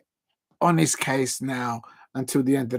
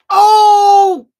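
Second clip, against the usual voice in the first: a sudden high loud cry of alarm; startled shout; 14 dB louder than usual. Real, not acted. Watching a near miss, a sudden loud cry. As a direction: yelp out loud at the chance. Penalty moment, shouted reaction.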